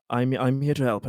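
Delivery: ordinary delivery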